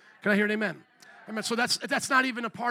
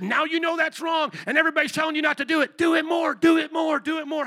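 loud, taunting push to do it more; sin's goading shout; 7 semitones higher, 6 dB louder than usual. Acted. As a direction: egg him on, shouting it louder each time. Sin voiced tempting him.

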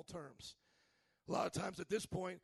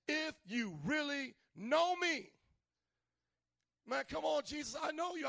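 sassy Jesus; 7 semitones higher than usual. Retold or imitated. Jesus answering Thomas with sass. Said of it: "he gets a little sassy".

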